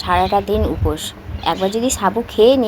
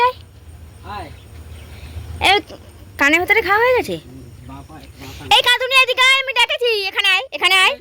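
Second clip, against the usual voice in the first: much higher, coaxing call; calling the cat; 10 semitones higher than usual. Real, not acted. Calling the cat over for its medicine, high coaxing call. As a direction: coax the cat over, sing-song.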